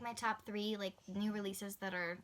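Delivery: ordinary talk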